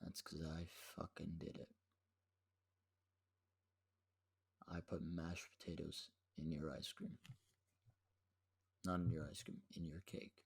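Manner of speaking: very soft, low, deliberate gloat; retold eye-to-eye confession. Prankster looking brother in the eyes, hushed confession. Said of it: "I looked him in his eyes and said".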